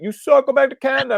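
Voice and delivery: high, loud heckle; yelling New Yorker. Acted. New Yorker heckling a Canadian visitor, mock angry yell. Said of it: "the New Yorker will yell out".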